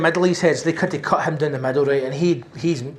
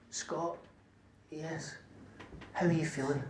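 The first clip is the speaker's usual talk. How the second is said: much softer nurse, coaxing; gentle nurse; 15 dB quieter, slower than usual. Retold or imitated. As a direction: softly coax the patient. Nurse voiced, soft.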